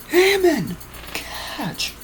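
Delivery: high shout of Hammond, whispered catch; high-pitched character calling out. Acted. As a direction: call the name high then hush it. Character calls out in story.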